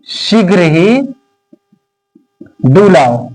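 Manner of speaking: teacher dictating to the class at x0.5; slow dictation. Acted. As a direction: read it out chunk by chunk for note-taking. Dictating the poem slowly.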